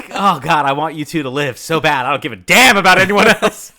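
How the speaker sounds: loud, high, hammed-up plea; impassioned fan outburst. Fan pleading for the two lovers to live, loud mock-desperate outburst. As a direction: build to a laughing yell.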